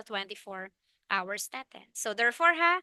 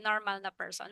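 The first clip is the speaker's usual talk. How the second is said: "normal" given quiet weight, softer and falling; stress on NORMAL; 4 semitones lower, 10 dB quieter than usual. Shown as a demonstration. Teacher reminding class it is a normal person, understated emphasis on "normal". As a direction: lean lightly on "normal" in a low aside. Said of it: "with emphasis".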